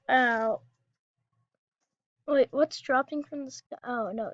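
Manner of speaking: normal talking voice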